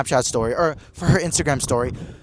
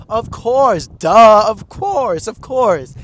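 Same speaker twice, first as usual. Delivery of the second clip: high, bright-eyed quoted voice of the singer; 6 semitones higher, 8 dB louder, much slower than usual. Retold or imitated. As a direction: imitate her cheerful of course. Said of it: "She's like".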